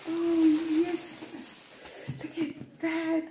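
very high, loud squeal of joy; ecstatic squeal. Real, not acted. Showing viewers the MK bag, squealing with joy. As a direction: shriek with delight at the bag.

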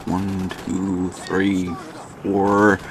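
nervous whispered countdown; whispered count. Real, not acted. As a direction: breathe out the count, hushed. Nervous countdown waiting on helicopter.

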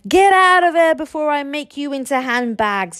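high, loud threatening yell. A narrator's reading. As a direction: bellow them out of the room. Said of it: "yelled Stoic".

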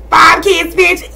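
high, loud bragging; bragging to viewers, loud and high; proud brag. Real, not acted. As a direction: show off to the viewers. Bragging about herself.

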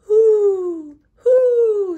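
high, loud hoot; hooting owl. Acted. Teacher voicing Owl, loud hooting. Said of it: "said Owl".

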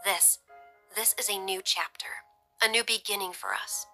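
hushed, thoughtful delivery with pauses; softly. A narrator's reading. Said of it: "she said softly".

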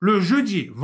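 Le jeudi with Le said high and loud; stress on le. Shown as a demonstration. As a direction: hit the article Le. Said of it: "buradaki le yerine le olsaydı".

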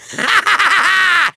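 triumphant villain laugh; evil cackle. Acted. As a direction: cackle after naming yourself. Villain announces himself, then gloats.

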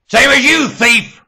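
high, very loud yell; explosive high shout; shouted accusation. Real, not acted. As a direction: hurl the accusation at full voice. Repeats it as a shout.

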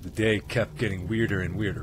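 ordinary talk

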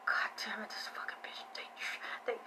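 hissed whisper of a furious man; whispered curse. Retold or imitated. Reader retelling a man's whispered curse, hushed and seething. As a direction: whisper the cursing like he's seething under his breath. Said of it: "And he's like".

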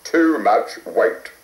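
lightly voiced RAF pilot; a hint of a brisk flight instructor. A narrator's reading.

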